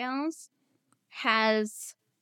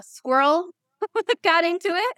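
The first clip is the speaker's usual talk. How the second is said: laughing; 9 semitones higher, 8 dB louder than usual. Real, not acted. Laughing at squirrel memory.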